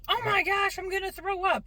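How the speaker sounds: high-pitched mock panic from a kid; dramatic child. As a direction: imitate an overdramatic sick child, high and loud. Child announcing she feels sick.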